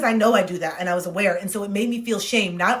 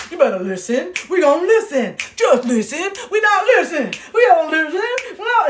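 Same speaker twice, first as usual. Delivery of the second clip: building to high, loud hammed-up yelling; frantic inner orders; 7 semitones higher, 5 dB louder than usual. Inner voice drowning out the friend, frantic escalating orders. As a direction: let the self-talk spiral up into a comic shout.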